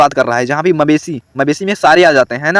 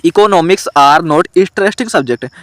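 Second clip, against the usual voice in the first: slow dictation read; much slower than usual. Shown as a demonstration. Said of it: "अगला देखो".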